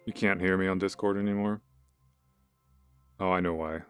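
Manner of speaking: ordinary delivery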